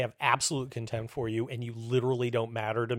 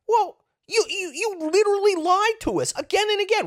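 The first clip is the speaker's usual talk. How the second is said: very high, sputtering disbelief; stammering squeaky outrage; exasperated retort; 4 dB louder than usual. Acted. Host answers officials who lied to him, exasperated.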